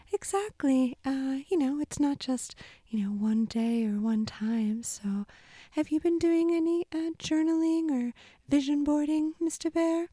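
very high, squeaky little mouse; chirpy tiny mouse character. Acted.

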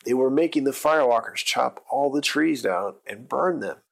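plain reading voice

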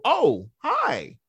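high, acted little hello; surprised greeting. Therapist free to greet a client back, surprised greeting. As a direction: act out a caught-off-guard hello. Models greeting after being acknowledged first.

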